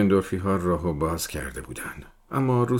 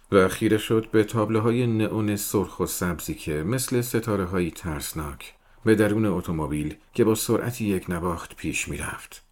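ordinary delivery, nothing marked

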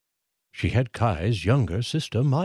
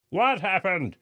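called out; 10 semitones higher than usual. A narrator's reading. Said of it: "someone called out".

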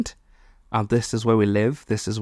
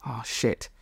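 ordinary talk, nothing marked

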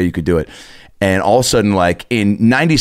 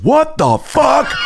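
high, slow shocked yell; shocked angry dad; much slower than usual. Retold or imitated. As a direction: bellow it out in disbelief. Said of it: "just goes".

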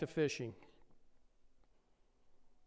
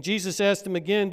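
normal talking voice

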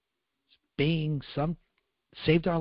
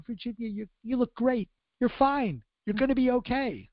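reassuring; 9 semitones higher than usual. Retold or imitated. Reassuring a badly burned friend.

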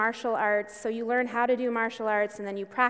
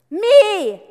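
a sudden, explosive shout, much higher and louder; 8 semitones higher, 14 dB louder than usual. Retold or imitated. Coach Chozen springs at the students with a shout. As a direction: leap out with a booming shout.

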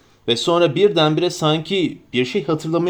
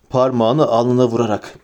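usual speaking voice

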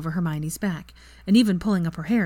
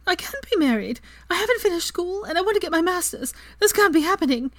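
higher, panicked and breathless; a mild touch of panicked disbelief; rising panic; 12 semitones higher than usual. A narrator's reading. Hermione panicking at being married before school ends, mild panic. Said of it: "starting to shake with shock".

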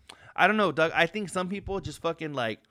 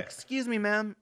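higher, softer, sugary excuse me; put-on sweet nice-guy voice; 6 semitones higher, 3 dB quieter than usual. Asking the clerk for the restroom, mock sweet and soft. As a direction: lay on the sweetness to win her over.

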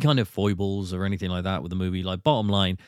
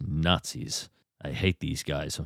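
low, soft, deadpan Indy grumble; lightly voiced Indiana Jones, lower and softer; Harrison Ford impression; 6 semitones lower, 6 dB quieter, slower than usual. Imitating Harrison Ford to friends, low deadpan grumble. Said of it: "the way he goes".

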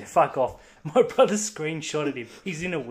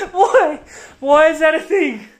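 higher and louder, laughing through it; laughing disbelief; 9 semitones higher, 6 dB louder than usual. Real, not acted. To his co-host about the tweet, laughing in disbelief. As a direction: crack up in disbelief.

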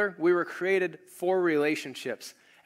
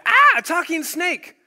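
high, loud, slow mock astonishment; amazed woman; 13 semitones higher, 10 dB louder than usual. The woman gawks at the talking serpent, mock amazed. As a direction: gasp it out as if a snake just spoke. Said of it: "And the woman replied".

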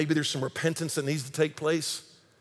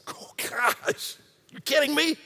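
awed disbelief; 8 semitones higher, 3 dB quieter, slower than usual. Real, not acted. Amazed reaction to lyric.

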